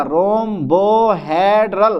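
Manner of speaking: "Rhombohedral" stretched out very slowly syllable by syllable; teacher dictating a spelling to the class; slow dictation. Shown as a demonstration. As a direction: draw out "rhombohedral" so students catch the h. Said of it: "एच लगेगा".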